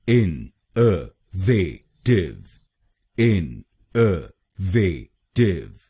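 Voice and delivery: इन अ वे टिव, each syllable spaced out slowly. Shown as a demonstration. Teacher slowly spelling out इन अ वे टिव for learners. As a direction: break the word into slow separate syllables with "vay". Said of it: "तो ये बनेगा innovative".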